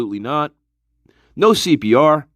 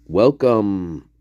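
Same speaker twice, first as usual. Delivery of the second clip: normal talking voice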